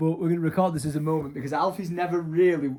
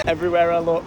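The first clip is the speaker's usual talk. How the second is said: ordinary talk, nothing marked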